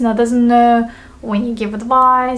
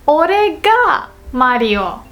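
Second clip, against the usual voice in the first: slow, higher, drawn-out protest; indignant Mario; 5 semitones higher, slower than usual. Acted. Mario confronting the impostor, acted indignant protest. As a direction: object firmly, leaning on the key words. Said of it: "Mario comes in and says".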